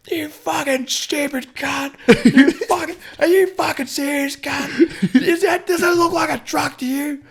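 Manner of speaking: high, strained imitated yelling; angry truck driver. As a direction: hurl abuse as a furious truckie. Acted shouting match, truck driver.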